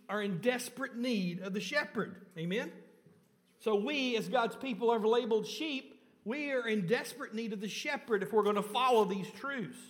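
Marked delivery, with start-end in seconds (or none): 3.6-9.8 a touch louder, higher preaching voice; rising preaching. Acted. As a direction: lift the congregation with building conviction. Preacher rising to full force.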